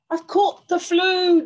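loud, high-pitched roar of complaint; roaring lion. Acted. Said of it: "the lion roared".

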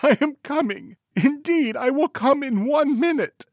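very high, loud distressed lady; mock-sobbing wretched wife. A narrator's reading. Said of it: "sobbed his wretched wife".

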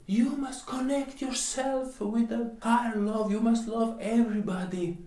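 high, preachy mock of a guru; mocking quote. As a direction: parody the sanctimonious teacher. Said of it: "They will all tell you".

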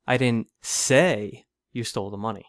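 deliberate, heavy emphasis on "say"; stress on SAY. Shown as a demonstration. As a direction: hit the word "say" hard. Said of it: "with emphasis on each word".

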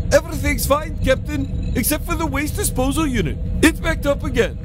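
ship's engineer voice. Acted. Engineer reporting waste disposal trouble to captain, high put-on voice. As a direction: pitch the voice way up for the engineer.

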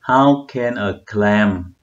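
very slow, word by word; careful, drawn-out practice reading. Shown as a demonstration. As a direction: read it slowly and deliberately. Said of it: "Đọc lại chậm hơn chút".

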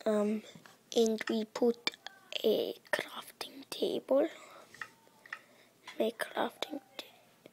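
hushed voiceless whisper; whispering while placing a crafting table. Real, not acted. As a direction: murmur it under your breath. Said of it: "I don't know why I'm speaking like this".

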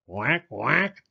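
quacking duck voice. Acted. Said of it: "called the duck".

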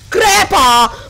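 angry shout. Real, not acted. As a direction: bark it at the enemy. Gamer cursing enemy. (grido rabbioso)

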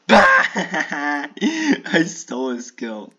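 real giggling glee; gleeful laughing. Real, not acted. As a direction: cackle over a stolen kill. Laughing at stolen kill.

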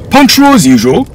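high, loud lordly greeting; pompous lord. Acted. Lord Beckett greeting the father, high and loud. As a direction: greet them like a grand host.

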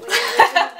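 high, loud, laughing wait; laughing panic. Real, not acted. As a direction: cry out through laughter.

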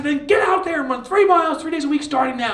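high, loud order; commanding shout. Retold or imitated. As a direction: send them running with a shout. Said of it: "They said".

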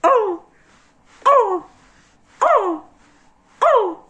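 high, grunting oh on each stroke; mock a tennis player grunting with each hit; tennis grunts. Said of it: "Just go like that".

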